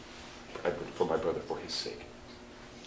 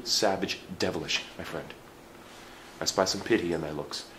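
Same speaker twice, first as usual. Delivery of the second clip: usual speaking voice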